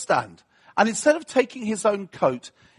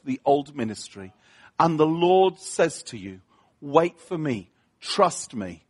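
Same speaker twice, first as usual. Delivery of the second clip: preaching; 4 semitones lower than usual. Acted. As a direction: speak God's words gently to the congregation.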